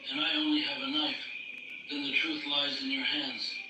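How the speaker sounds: ordinary talk, nothing marked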